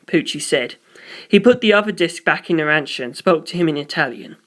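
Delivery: usual speaking voice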